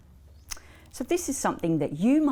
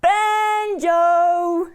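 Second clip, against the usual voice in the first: long, high, loud holler; belted-out singsong dog call; extra-loud call; 10 semitones higher, 8 dB louder than usual. A narrator's reading. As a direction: holler for a dog hard of hearing. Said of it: "had to call out extra loud".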